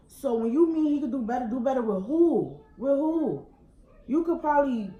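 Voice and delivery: loud, high, heated demand; angry challenge. Real, not acted. Woman challenging the girl's claim, loud and angry. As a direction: shout the question back at her.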